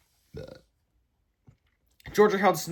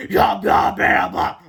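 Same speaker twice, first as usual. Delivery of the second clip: evil toilet cry; 2 semitones higher, 8 dB louder, much slower than usual. A narrator's reading. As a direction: growl it like a hungry monster toilet. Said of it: "they cried".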